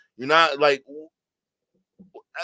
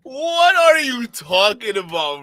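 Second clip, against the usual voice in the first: loud, high, slow incredulous question; exasperated disbelief; 6 semitones higher, 8 dB louder, slower than usual. Real, not acted. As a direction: drag the question out in bafflement. Baffled reaction to the clip.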